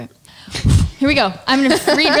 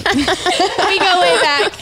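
laughing; 4 semitones higher, much slower than usual. Real, not acted. Joking about [name].

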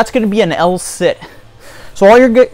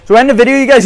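out of breath; 6 semitones higher, 4 dB louder, much faster than usual. Real, not acted. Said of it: "I'm a little out of breath".

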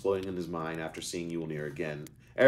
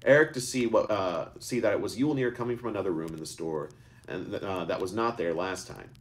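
usual speaking voice

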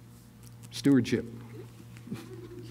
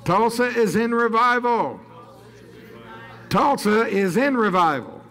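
high, ringing proclamation; preacher's proclamation; 8 semitones higher than usual. Real, not acted. Preacher declaring revival over Tulsa, proclaiming. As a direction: proclaim it over the city in sustained, raised pitch.

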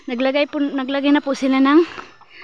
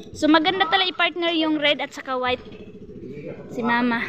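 usual speaking voice

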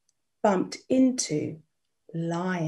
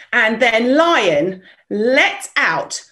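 slow, growing louder to a roaring shout; building roar; 8 dB louder, slower than usual. A narrator's reading. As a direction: swell it into a huge roar.

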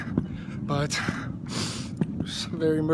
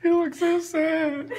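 tearful; 10 semitones higher than usual. Real, not acted. Goodbye, tearful.

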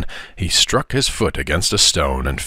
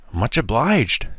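put-on mildly earnest, slightly high boy's voice; understated sincere acknowledgment; serious thanks; 5 semitones higher, slower than usual. A narrator's reading. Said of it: "said Tom seriously".

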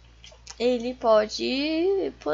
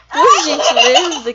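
loud, laughing mock politeness; 2 semitones higher, 11 dB louder than usual. Real, not acted. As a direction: laugh through a fake polite plea.

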